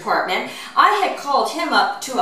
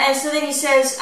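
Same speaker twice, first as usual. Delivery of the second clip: usual speaking voice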